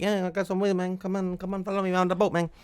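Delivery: Mexican dealer impression. Dealer luring a tourist to his boat, soft high impression.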